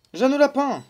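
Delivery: high, loud mock call. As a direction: belt the name out high. Calling out the character's name.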